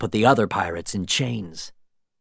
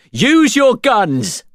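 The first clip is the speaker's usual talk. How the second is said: high, loud, slow order; commanding shout; 12 semitones higher, 6 dB louder, much slower than usual. A narrator's reading. Norrington ordering his sailors to shoot, slow loud shout. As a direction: drive the order home.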